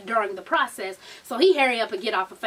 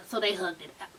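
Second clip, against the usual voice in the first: normal talking voice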